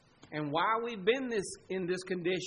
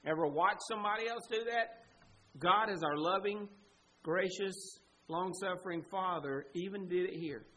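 ordinary delivery

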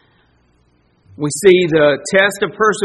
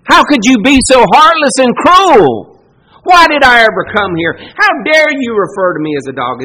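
loud, high, wounded protest; indignant outcry; 4 semitones higher, 10 dB louder than usual. Pretend. Preacher voicing an offended hearer, loud indignant cry. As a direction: hurl the accusation back, outraged.

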